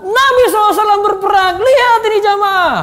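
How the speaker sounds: high, loud, fast war cry; rousing battle narration. Shown as a demonstration. Preacher telling congregation of the Prophet at war, rousing shout. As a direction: burst out to jolt the congregation awake. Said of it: "Ada suasana lagi ceritakan perang".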